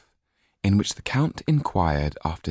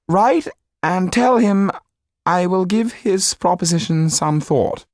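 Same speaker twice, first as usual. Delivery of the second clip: shaken Marquis; 6 dB louder than usual. A narrator's reading. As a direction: concede while keeping his pride. Marquis conceding.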